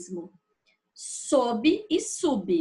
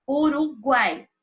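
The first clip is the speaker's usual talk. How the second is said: Uruguai said slowly, louder, guai held together; example word stressed; 2 semitones higher, 5 dB louder than usual. Shown as a demonstration. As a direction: set Uruguai apart slowly with guai kept whole. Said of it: "guai, tritongo, não se separa". (Uruguai dito devagar e com ênfase)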